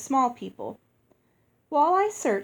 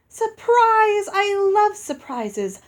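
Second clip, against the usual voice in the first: high, loud, thrilled giantess; delighted squeal; 11 semitones higher, 7 dB louder, slower than usual. Acted. Giantess Uoxi thrilled at the surprise, high and delighted. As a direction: squeal it with childlike glee.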